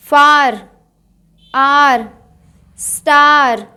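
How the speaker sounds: "फार, आर, स्टार" with a wide, long aa; long aa vowel. Shown as a demonstration. Teacher showing learners the big aa, "फार, आर, स्टार" with long aa. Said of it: "ये आ का उच्चारण".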